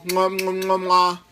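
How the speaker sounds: blown kisses. Real, not acted. Blowing kisses to viewers.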